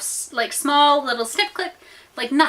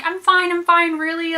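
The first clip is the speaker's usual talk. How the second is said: higher-pitched retold protest; insistent reassurance; 7 semitones higher than usual. Embarrassed swimmer refusing to be carried, insistent. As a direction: insist you need no help, voice lifted.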